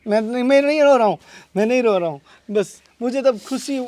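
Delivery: real tearful voice, soft and breaking off; crying, denying it. Real, not acted. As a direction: insist softly through the tears. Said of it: "तुम ही चुप हो जाओ".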